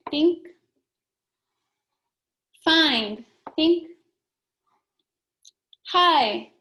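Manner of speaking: high bright Think cue each time; quick call and response reading drill; teacher cue. Shown as a demonstration. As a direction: prompt the class to read. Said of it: "ready to think and read".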